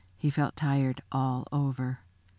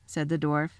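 ordinary delivery, nothing marked

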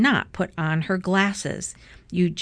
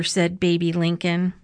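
ordinary delivery

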